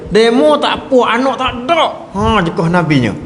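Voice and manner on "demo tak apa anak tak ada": high-pitched scolding outburst; retold indignant retort. Grieving woman rebuffing the Prophet, acted high indignant retort. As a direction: throw it back, high and indignant.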